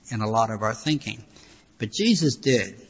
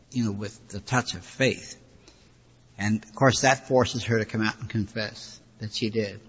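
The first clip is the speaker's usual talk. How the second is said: ordinary talk, nothing marked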